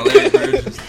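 cracking up while talking; laughing. Real, not acted. Laughing at his own point.

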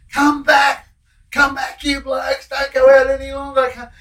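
high, loud bellow up the cell block; calling out in a high, loud voice; mock prisoner's yell. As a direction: yell it down to the men at the back gate. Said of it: "he yells out".

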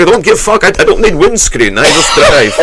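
put-on Russian accent. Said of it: "as if I'm driving through Russia".

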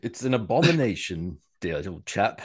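hammed-up English accent, pipe in mouth; Lewis, English accent. Co-host jokingly echoes the friend as Lewis, mock English accent. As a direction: do a mock English don talking round a pipe. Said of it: "this would be Lewis".

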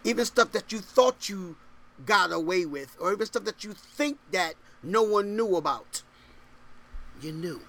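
ordinary talk, nothing marked

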